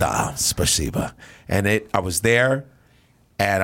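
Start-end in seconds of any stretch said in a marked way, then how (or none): 0.0-1.1 mock Russian thanks.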